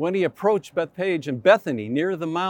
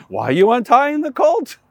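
higher, louder demanding question; indignant owner; 6 semitones higher, 9 dB louder than usual. Retold or imitated. Colt owner confronting the disciples, loud and demanding. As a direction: challenge them as the colt's owner.

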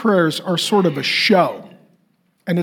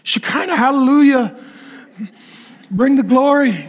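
higher, showy churchy prayer voice; mock fancy holy words; 6 semitones higher than usual. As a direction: ham up a pompous holy prayer. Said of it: "they sound like fancy holy words".

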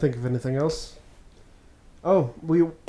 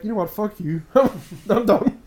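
higher-pitched mock fed-up reply; mock indignation; 7 semitones higher, 3 dB louder than usual. Imagined reply to a snide letter, mock fed-up. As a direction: throw it back as a mock huff.

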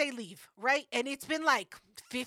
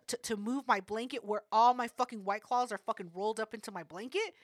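normal talking voice

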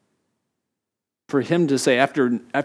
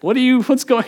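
mock startled outburst; 11 semitones higher, 7 dB louder than usual. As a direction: act the shocked parent walking in. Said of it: "It's like".

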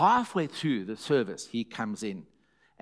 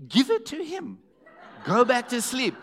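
much higher, laughing mock wife voice; dismissive wife; 10 semitones higher than usual. Wife telling her husband to just give it away, high laughing dismissal. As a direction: brush it off like it's obvious. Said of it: "So she just goes".

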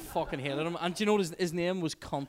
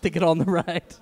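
laughing; 4 semitones higher, 11 dB louder than usual. Retold or imitated. Retold line at the ride.

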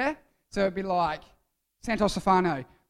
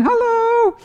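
very high, loud cartoon greeting; booger popping out saying hello; 12 dB louder than usual. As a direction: peek out with a piercing, held hello. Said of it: "it pokes its head out the cave again".

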